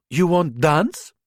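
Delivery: slow, high, hammed-up Russian accent; mock Russian accent. Martha teasing Kite, inviting him to dance, in a hammed-up slow Russian accent. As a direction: stretch it out in a silly Russian accent. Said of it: "in a mock Russian accent".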